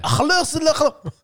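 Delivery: laughing. Real, not acted. Joking Arabic, laughing.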